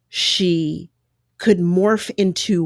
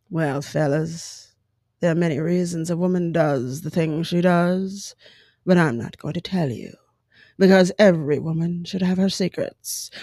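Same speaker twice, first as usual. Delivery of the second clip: serious radio woman. Retold or imitated. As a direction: deliver it gravely, like an old radio mystery. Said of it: "serious woman who'd be like".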